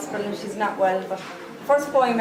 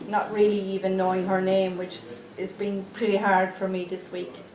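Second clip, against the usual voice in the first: ordinary talk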